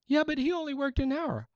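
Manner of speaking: high whining protest of a cheated worker; whiny worker complaint. Retold or imitated. Worker who toiled all day whines to the landowner. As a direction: whine like a child denied his fair share. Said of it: "the guys that worked all day said".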